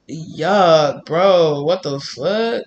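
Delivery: real drawn-out disgust. Real, not acted. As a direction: stretch it out, utterly grossed out. Disgusted reaction to the energy.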